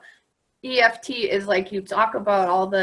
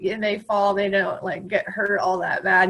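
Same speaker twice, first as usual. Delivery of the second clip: ordinary delivery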